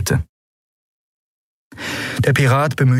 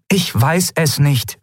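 slow, higher, word by word; insistent repeat; 7 semitones higher, slower than usual. A narrator's reading. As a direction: stretch each word of the admission. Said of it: "wiederholte sie".

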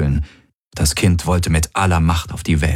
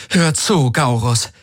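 hard; 7 semitones higher, slower than usual. A narrator's reading. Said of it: "sagte Kirai mit aller Härte".

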